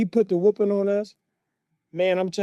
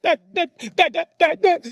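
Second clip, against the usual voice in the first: high, staccato stammering like Morse code; tearful stutter; 9 semitones higher, 6 dB louder than usual. Retold or imitated. Stuttering brother explaining himself to father, tearful stutter. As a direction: imitate a kid stuck on one word. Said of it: "sounded like he was sending Morse code in tears".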